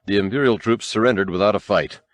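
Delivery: ordinary delivery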